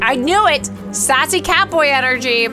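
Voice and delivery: thrilled, high-pitched interruption of the reading; excited outburst. Real, not acted. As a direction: blurt it out with gleeful vindication.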